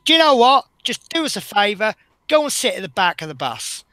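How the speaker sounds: mock-stern bus driver snapping at a rude passenger; fed-up order. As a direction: send him to the back, firm and loud. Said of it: "And I just went".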